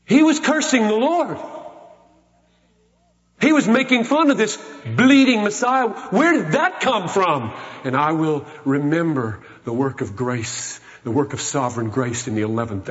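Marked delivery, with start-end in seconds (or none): 0.0-7.5 astonished preaching. Acted. Preacher asks where the thief's faith came from, rising amazement.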